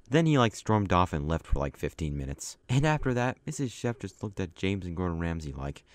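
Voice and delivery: normal talking voice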